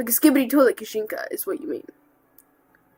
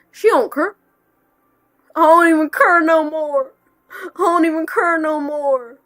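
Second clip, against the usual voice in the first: higher, loud whiny despair; dramatic whine; 6 semitones higher, 7 dB louder than usual. Pretend. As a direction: wail it like giving up. Put-on despairing voice, far.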